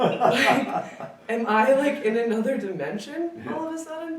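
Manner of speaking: high, loud and laughing, half asking; bursting into bewildered laughter at the memory; laughing disbelief. Real, not acted. Telling friends about the strange man, laughing in disbelief.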